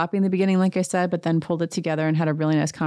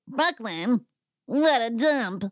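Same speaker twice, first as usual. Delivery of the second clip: put-on higher, slow sneering drunk voice; drunk Erica; 5 semitones higher, slower than usual. Host imitating drunk Erica at dinner, slow higher sneer. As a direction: sneer it out slowly, tipsy. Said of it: "drunk Erica is like".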